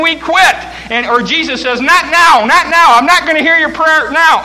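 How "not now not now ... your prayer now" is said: dismissive refusal. Acted. Voicing Jesus refusing.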